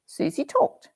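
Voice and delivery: ordinary delivery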